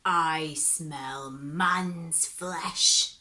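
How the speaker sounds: very slow, low, menacing growl; suspicious devil. Acted. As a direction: draw it out like a sniffing beast.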